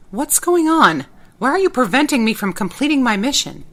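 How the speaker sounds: loud, high alarmed demand. Acted. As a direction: shout it out in alarm. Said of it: "I asked".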